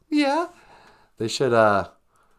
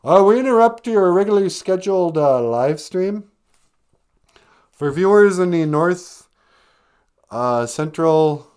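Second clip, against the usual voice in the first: louder, announcer-style news interruption; mock news bulletin; 6 semitones higher, 6 dB louder than usual. The host hams up a breaking weather bulletin for the viewers. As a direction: break in like a TV newsreader.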